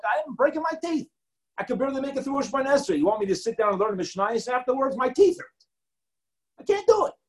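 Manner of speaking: whining complaint. Retold or imitated.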